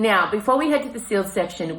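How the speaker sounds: ordinary talk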